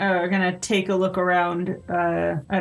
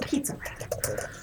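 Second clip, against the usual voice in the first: whispered; 6 semitones higher, 10 dB quieter, slower than usual. Pretend. Whispered repeat of name.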